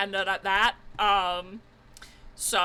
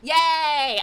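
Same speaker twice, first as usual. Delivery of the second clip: cheer; 4 semitones higher, 9 dB louder than usual. Real, not acted. Game success.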